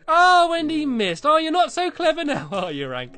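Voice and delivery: gloating tease. Real, not acted. Teasing dog who missed.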